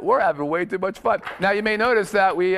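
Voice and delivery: ordinary delivery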